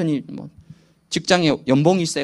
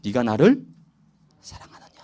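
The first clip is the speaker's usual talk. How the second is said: gentle question; 7 semitones lower than usual. Retold or imitated. Jesus gently asking Peter if he loves him, tender. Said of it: "뭐라 얘기합니까?".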